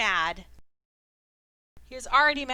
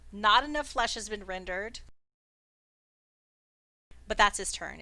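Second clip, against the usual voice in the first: usual speaking voice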